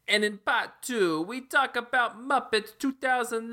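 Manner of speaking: high froggy puppet voice, well above his own; host intro as a frog puppet; a silly Kermit the Frog voice. Pretend.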